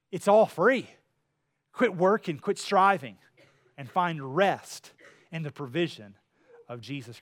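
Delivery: fervent appeal. Acted. Preacher's climactic appeal.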